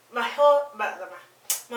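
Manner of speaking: stumbling over the opening words; tongue-tied. Real, not acted. Vlogger fumbling her hair review, tongue-tied. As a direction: trip on the words and restart, really tongue-tied. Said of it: "I'm getting tongue tied".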